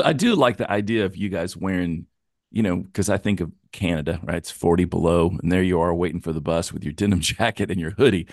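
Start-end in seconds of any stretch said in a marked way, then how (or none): none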